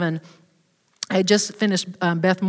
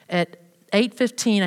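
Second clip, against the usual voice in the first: normal talking voice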